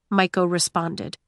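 ordinary delivery, nothing marked

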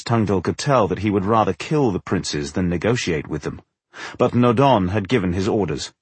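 ordinary delivery

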